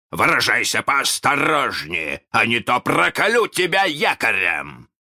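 high, shouted threat; loud mock-captain warning; imitating Hook's voice. A narrator's reading. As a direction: threaten like a blustering pirate captain. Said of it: "его же голосом".

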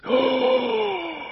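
sharp high gasp; the crowd gasps in shock. Acted. The crowd gasps at the name, sharp and high. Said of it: "Вздохнула толпа".

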